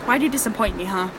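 ordinary talk, nothing marked

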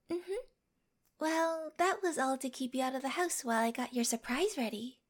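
ordinary delivery

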